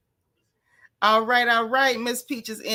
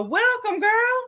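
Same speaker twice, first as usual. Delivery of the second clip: excited welcome; 11 semitones higher, slower than usual. Real, not acted. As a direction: sing out the welcome to a newcomer. Welcoming a new subscriber.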